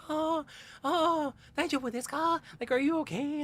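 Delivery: high-pitched imitation of a fussing couple; concerned onlookers. Japanese couple checking on stung tourist, high-voiced concern. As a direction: coo with concern, voice lifted way up.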